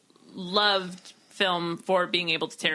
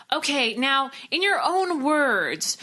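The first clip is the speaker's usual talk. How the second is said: higher, slow, drawn-out instruction; mock teacher; 6 semitones higher, much slower than usual. Teacher setting a film class assignment, mock-instructive. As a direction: spell out the task like a teacher.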